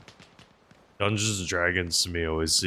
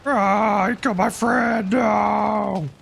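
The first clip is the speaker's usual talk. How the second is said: shout; 14 semitones higher, 5 dB louder, slower than usual. Real, not acted. Friend killed in game, cries out.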